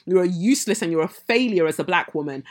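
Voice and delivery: usual speaking voice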